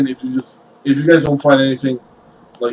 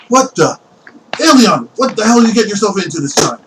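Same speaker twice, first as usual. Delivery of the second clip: exasperated father; 7 semitones higher than usual. Acted. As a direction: scold your wayward son in disbelief. Lord scolds his son.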